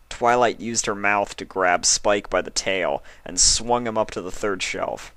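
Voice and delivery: usual speaking voice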